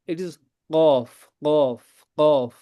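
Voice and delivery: qaf with a deep throat k; throaty qaf. Shown as a demonstration. Teacher demonstrates qaf deep in the throat. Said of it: "If you have to pronunciate qaf".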